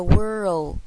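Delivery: "world" drawn out, with 肉 in the middle; loud, slow "world" as wor-rold; 肉 sound inside. Shown as a demonstration. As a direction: stretch "world" so a "row" sound appears. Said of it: "这中间就会有一个肉的声音".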